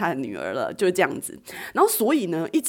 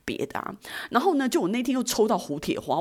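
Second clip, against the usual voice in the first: usual speaking voice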